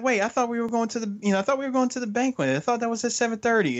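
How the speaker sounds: put-on high, fast confused girlfriend. Friend voicing the girlfriend's confusion, high and fast. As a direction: rattle it off like a puzzled girlfriend. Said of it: "she's like".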